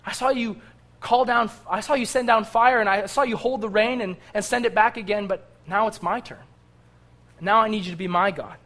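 loud, high desperate plea; crying out to God. Retold or imitated. Elisha demanding his own turn from God, loud cry. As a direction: shout it up to heaven, begging. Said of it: "He cried, he said".

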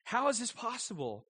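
ordinary talk

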